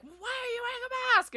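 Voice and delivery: very high, strained mock outrage; mock scolding. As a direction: squeal the accusation like an indignant onlooker. Voicing glaring people's unspoken challenge.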